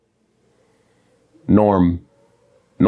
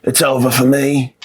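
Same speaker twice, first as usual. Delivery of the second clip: slow, hammed-up deathbed moan; milk it like you're on your deathbed; dying patient; 3 semitones higher, slower than usual.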